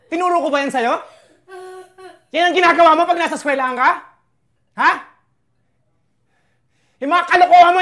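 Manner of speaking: put-on loud, relentless shouting at his child; angry father yelling; furious scolding. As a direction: tear into the kid for fighting at school. Father scolding child about fighting.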